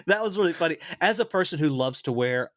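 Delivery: ordinary delivery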